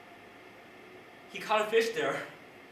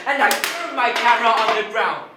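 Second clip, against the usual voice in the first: high, very loud outburst; explosive climax of a heated story; furious shouting; 7 semitones higher, 15 dB louder than usual. Retold or imitated. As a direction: yell it at the breaking point. Climax of the retold quarrel.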